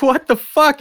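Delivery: a shocked, confused blurt; high panicked exclamation; panicked outburst. Retold or imitated. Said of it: "And I'm just like".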